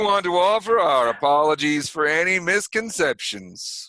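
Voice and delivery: put-on sheriff's official address in a New York accent. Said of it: "here we are speaking in an accent".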